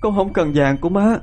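high tearful pleading through sobs; crying, begging. A narrator's reading. Starving wife begs her mother-in-law to open the door, sobbing. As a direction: beg desperately at the door, crying. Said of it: "mà khóc lóc van xin".

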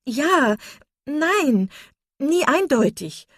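high, loud, distressed explanation; agitated. A narrator's reading. Marian admits love was never openly declared, agitated.

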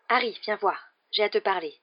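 usual speaking voice

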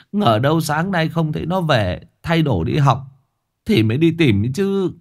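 plain reading voice

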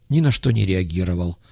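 ordinary delivery, nothing marked

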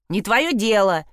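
ordinary delivery

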